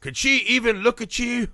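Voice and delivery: high, mocking retort; mock accusing family. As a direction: sneer it like a judgy relative. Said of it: "they're like".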